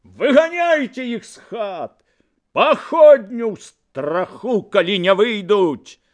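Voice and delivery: high, loud orders to the men; furious rallying yell; angry shouting. A narrator's reading. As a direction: build from exasperation into shouted commands. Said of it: "крыкнуў Корчак".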